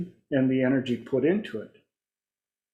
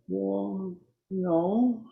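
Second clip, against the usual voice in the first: small hesitant voice, higher and much softer; reluctant client; 6 semitones higher, 6 dB quieter than usual. Retold or imitated. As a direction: shrink back softly, imitating a reluctant client. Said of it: "they're afraid to use the energy".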